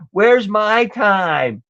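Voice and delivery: slow, drawn-out mocking whine. Mocking a guest who complains about airtime, slow whine. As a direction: mimic her whining for airtime. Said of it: "now she's crying and whining about".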